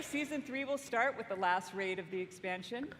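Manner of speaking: ordinary talk, nothing marked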